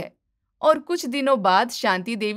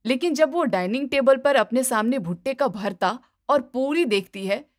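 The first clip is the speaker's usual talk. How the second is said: ordinary delivery, nothing marked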